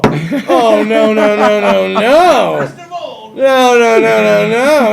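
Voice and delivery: loud mock protest; cutting her off, no no no; emphatic refusals. Refusing to pay for her food, loud mock protest. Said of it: "And I'm all".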